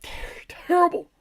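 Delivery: high, breathy mock whisper. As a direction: gasp it out in fake horror. Mock despair at small gain.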